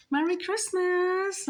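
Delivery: high, slow, drawn-out holiday greeting; sing-song, high-voiced farewell; imitated well-wishing. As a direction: mimic his wish, stretched out up high. Voices the man's farewell wish.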